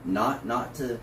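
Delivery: normal talking voice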